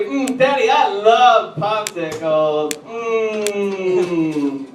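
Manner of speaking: hammed-up sugary little girl, dragged out slowly; mock little girl. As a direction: play the daughter gushing over a popsicle, slow. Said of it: "She was like".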